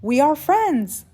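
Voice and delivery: high, loud happy declaration; excited. Acted. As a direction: announce it with delighted emphasis. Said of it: "so excited".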